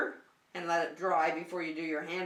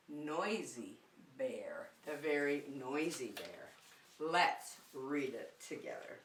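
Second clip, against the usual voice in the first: hushed, secretive whisper of the title; 6 semitones lower, 9 dB quieter, slower than usual. Acted. Teacher teasing today's story title to children, whispered.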